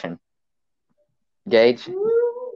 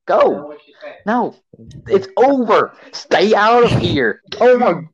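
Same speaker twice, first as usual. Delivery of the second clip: high, mock-angry yelling; hammed-up exasperated shouts; exasperated shooing; 9 semitones higher than usual. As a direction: chase the audience away, voice pitched up. Shooing viewers away at the end.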